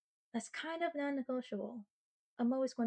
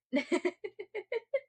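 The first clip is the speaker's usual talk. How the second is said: high laugh; 10 semitones higher than usual. Real, not acted. Laughs after freezer joke.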